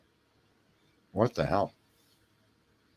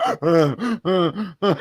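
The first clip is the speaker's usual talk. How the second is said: imitated gasping; 3 semitones higher, 3 dB louder, much slower than usual. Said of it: "she'd start".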